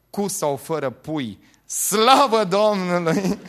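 slow build into a loud drawn-out "slavă Domnului"; shouting. Retold or imitated. Temperamental man forgetting the deal, slow loud shout. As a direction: stretch it and let the praise burst out. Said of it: "a strigat".

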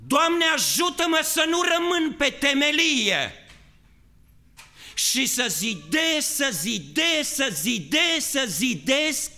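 high, loud pleading cry to God; fervent shouted prayer. Acted. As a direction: cry out to the Lord with all your strength. Rugăciune strigată. (rugăciune strigată, tare și sus)

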